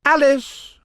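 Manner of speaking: very high, loud announcement; reading out. A narrator's reading. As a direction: call the name across the court.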